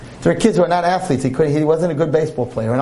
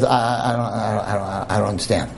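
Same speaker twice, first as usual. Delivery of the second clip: low, softer stammering disbelief; lost for words; stunned stammer; 7 semitones lower, 3 dB quieter than usual. Retold or imitated.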